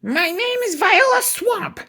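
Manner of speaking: slow, very high, scratchy; scratchy witch voice. A narrator's reading. Viola Swamp introduces herself to the class in a scratchy voice. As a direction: announce yourself like a menacing witch. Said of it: "said the lady in a scratchy voice".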